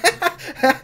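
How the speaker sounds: high giddy giggle; high, pleased little laugh; acted giggle. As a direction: giggle at your own success. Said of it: "like, giggling about it".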